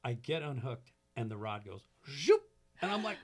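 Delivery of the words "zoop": loud, high "zoop" for the rod flying off; mock whoosh. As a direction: make the rod's whoosh as one sharp burst. Sound of rod flying away.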